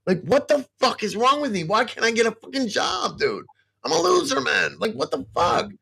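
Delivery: high, strained anguish; real distress. Real, not acted. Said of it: "I don't mean to break down like this".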